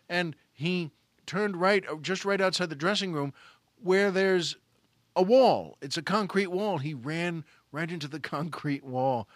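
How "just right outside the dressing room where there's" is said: usual speaking voice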